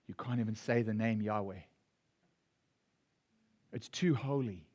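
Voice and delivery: put-on low reverent hush of old teachers; hushed religious warning. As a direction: whisper it like a forbidden name.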